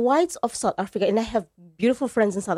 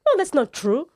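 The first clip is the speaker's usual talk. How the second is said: higher-pitched mock protest; acted objection; 6 semitones higher than usual. Speaker playing someone who challenges pub talk, higher-pitched protest.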